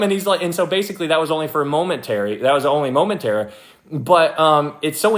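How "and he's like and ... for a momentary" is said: ordinary talk, nothing marked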